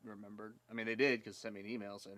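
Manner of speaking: ordinary talk, nothing marked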